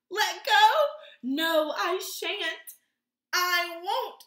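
cling on and shout no; frightened shout. Acted. Kevin refusing the crowd below, loud scared shout. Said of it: "shouted Kevin".

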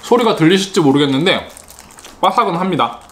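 talking between bites; chewing while talking. Real, not acted. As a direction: mouth full of chicken. Seen 2: mouth closed chewing mid-phrase, bites chicken again at 2.8.

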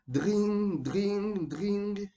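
high, sustained ringing "dring"; imitated telephone ring. A narrator's reading. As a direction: trill three long high rings like an old telephone. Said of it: "le roi téléphona".

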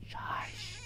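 a hushed warning whisper; whispered warning to himself; urgent whisper. Real, not acted. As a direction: hush yourself so it can't hear. Hiding from a monster.

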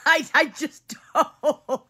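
high, slow words through a laugh. Real, not acted. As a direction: giggle the words out. Laughing after singing.

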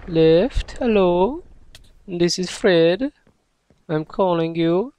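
high, loud pretend caller voice; mock phone call. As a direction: play a silly caller ringing up.

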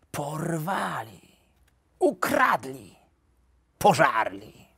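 slow, drawn-out tragic exclamations; melodramatic, stretched-out mock despair; tragic lament. A narrator's reading. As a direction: cry each word out like a stage tragedy. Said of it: "zakrzyknie tragicznie".

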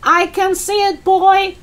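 put-on high old-woman holler; shouting back. Grandma answers George from the roof, shouting. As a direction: holler a reply down to the boy. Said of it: "the old girl shouted back".

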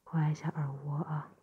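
gentle ear-cleaning whisper. Acted.

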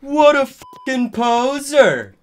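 high, loud indignant shout; angry shout. A narrator's reading. Draco to his goth friends about the poser, angry shout. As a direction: shout it in outraged disgust. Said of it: "Draco shouted angrily".

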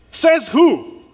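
high, loud, punchy "Says who?"; loud challenge. Acted. Preacher challenging his congregation, loud and defiant. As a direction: fling the question out at them.

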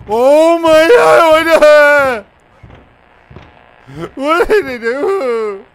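very high, very loud, slow wailing shriek; horrified shriek. Real, not acted. Gamer shrieking at a gruesome find, horrified. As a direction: wail in horror at what you see.

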